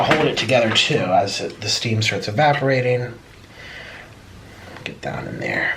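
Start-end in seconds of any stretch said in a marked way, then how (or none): 4.8-5.7 breathy whisper to the food. Real, not acted. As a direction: whisper it to the bowl. Whispers then repeats louder.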